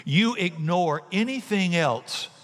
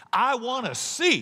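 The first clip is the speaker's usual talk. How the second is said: eager plea; 9 semitones higher than usual. Acted. Beggar pleads to Jesus.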